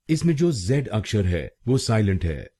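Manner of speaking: ordinary talk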